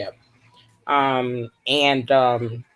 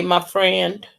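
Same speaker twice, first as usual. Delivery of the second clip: ordinary talk, nothing marked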